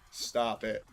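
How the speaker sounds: ordinary talk, nothing marked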